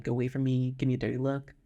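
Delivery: ordinary talk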